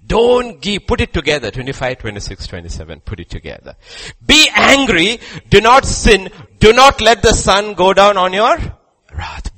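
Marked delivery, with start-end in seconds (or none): 4.2-9.6 loud, high proclamation of the verse; preacher thundering the verse to the congregation; shouted scripture. Acted. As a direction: hammer each word out at the crowd. Preaching the verse at full force.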